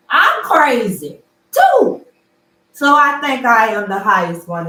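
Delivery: proud loud claim; loud emphatic. Real, not acted. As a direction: belt it out defiantly. Mocking being called crazy.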